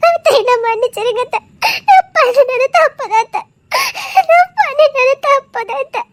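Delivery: begging forgiveness through sobs; girl sobbing as she begs the aunt's forgiveness; sobbing plea. Acted. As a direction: plead again and again, breaking down.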